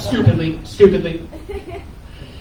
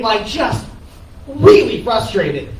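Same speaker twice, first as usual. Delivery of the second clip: usual speaking voice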